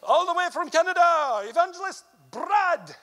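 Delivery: high, loud announcer call; announcer introduction. Retold or imitated. Retelling how hosts introduced him, loud announcer voice. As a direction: announce the guest evangelist grandly. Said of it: "every time I'd get introduced".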